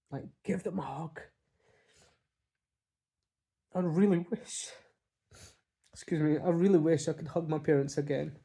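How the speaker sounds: tearful, voice cracking with grief; fighting back tears, real grief; voice breaking. Real, not acted. Grieving, pauses, "excuse me.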